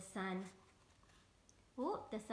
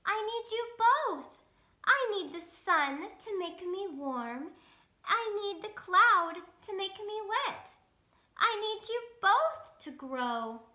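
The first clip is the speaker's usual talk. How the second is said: much higher, louder pleading seed; little seed voice; 8 semitones higher, 5 dB louder than usual. Acted. Tiny seed begging sun and cloud to stop, high. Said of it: "The seed says softly".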